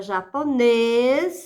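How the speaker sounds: "japonês" with a held, strong "nês"; stress on final syllable. Shown as a demonstration. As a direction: lean on the end of "japonês". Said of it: "sílaba tônica é o nês". ("japonês" com "nês" forte e alongado)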